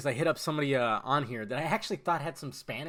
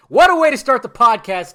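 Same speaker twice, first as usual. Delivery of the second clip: high, loud announcer-like burst; loud exclamation; 7 semitones higher, 14 dB louder than usual. Real, not acted. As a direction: call it out big and high. Mock exclamation at his own opening.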